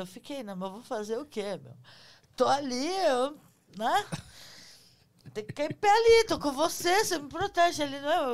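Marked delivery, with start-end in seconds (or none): 2.4-8.3 high, loud scared plea; cling to him in panic; frightened pleading. Retold or imitated. Retells own fright, clinging.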